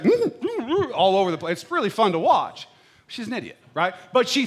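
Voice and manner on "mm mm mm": high-pitched cat-like mm sounds; cat noises. Retold or imitated. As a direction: squeak out mocking cat hums. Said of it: "The cat's like".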